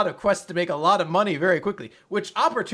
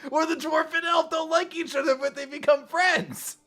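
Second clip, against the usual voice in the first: mock outrage at a tired trope; exasperated shout; 10 semitones higher than usual. Real, not acted. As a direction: throw up your hands and yell it at Nick. Exasperated rant at cohost.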